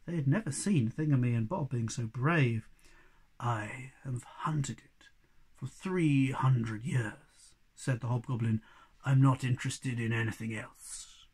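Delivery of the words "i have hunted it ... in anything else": low, very slow hushed goblin voice; whispering hobgoblin. Acted. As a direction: breathe it like an ancient hunter.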